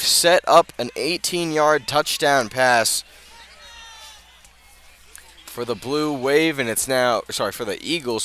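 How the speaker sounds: usual speaking voice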